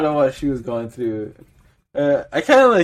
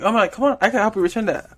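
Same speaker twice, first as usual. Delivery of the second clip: mock eager helpfulness; 7 semitones higher, faster than usual. Overly helpful clerk to angry customer.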